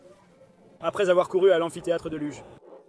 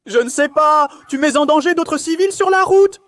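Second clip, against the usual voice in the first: loud, high-pitched scolding; heated shout; 7 semitones higher, 5 dB louder than usual. Real, not acted. Officer and suspect argue over dangerous driving, heated shout. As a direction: raise the voice sharply to argue.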